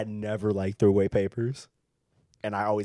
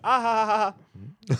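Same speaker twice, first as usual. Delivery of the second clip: exaggerated fake classmates' laugh; imitating a dumb classroom laugh; hammed-up ha ha ha; mock laughter; 9 semitones higher, 4 dB louder than usual. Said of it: "And they're like".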